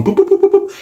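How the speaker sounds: high-pitched imitated keypad boops; quick string of sung-out boops; phone dialling beeps. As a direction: mimic phone buttons in a squeaky voice.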